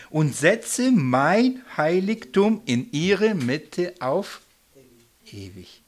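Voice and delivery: a loud, solemn Bible reading; scripture reading. Acted. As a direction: proclaim the verse to the room. Reads scripture aloud.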